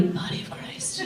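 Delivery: hushed voiceless whisper. Retold or imitated. Someone behind her whispering, voiceless and hushed. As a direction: breathe it from behind like a secret. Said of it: "I heard from behind me".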